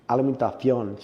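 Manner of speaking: alimentación said the Spanish way, with a th sound before the ending; Spanish word. Shown as a demonstration. Explaining a Spanish word to the interviewer, said in Spanish. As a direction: say alimentación as a Spanish word, stressing the last syllable. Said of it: "they call it in Spanish".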